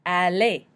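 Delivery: Aller as French ah-LAY; silent final R. Shown as a demonstration. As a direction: say Aller ending in an AY, no R. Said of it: "we do not pronounce the R".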